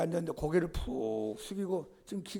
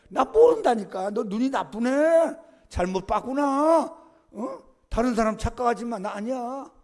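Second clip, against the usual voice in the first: higher, louder, slow irritated denial; angry denial; 6 semitones higher, 7 dB louder than usual. Retold or imitated. Peter snapping at the second servant girl, loud and high. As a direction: brush off the accuser angrily.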